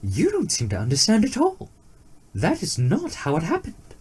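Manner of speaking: high, earnest explanation; eager deer correcting. A narrator's reading. Deer correcting the rabbit, high earnest tone. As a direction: set him straight, voice lifted. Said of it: "the deer explained".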